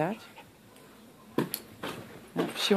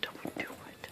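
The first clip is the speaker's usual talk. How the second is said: whispered warning; 18 dB quieter than usual. Retold or imitated. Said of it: "Doug's like".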